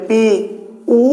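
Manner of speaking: পি, then a pause, then উ; split vowels. Shown as a demonstration. Teacher asking if we say it so, vowels split. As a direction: break পি and উ into two sounds. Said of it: "উ না, কি একসাথে বলতে হচ্ছে".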